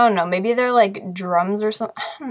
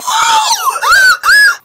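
loud, high gasp of delight; excited gasp; 7 dB louder than usual. Real, not acted. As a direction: gasp in sheer delight at the toy. Opening toy, sees plushie.

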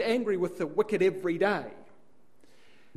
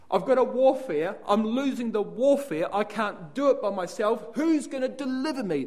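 higher, louder, anguished cry for help; desperate struggling believer; 5 semitones higher, 5 dB louder than usual. Acted. Preacher voicing Paul's desperate cry to the congregation. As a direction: give voice to Paul's despair, pleading.

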